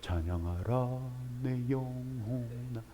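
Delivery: soft, slow, solemn hymn line; a touch of a reserved church choir; restrained hymn. Retold or imitated. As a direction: sing it quietly and dignified. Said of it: "백인스럽게 찬양을 해요".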